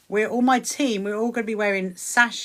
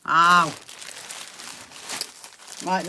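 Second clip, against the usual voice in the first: normal talking voice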